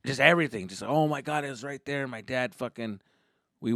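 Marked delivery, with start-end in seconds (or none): none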